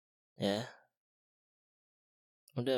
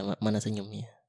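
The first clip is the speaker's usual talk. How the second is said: ordinary delivery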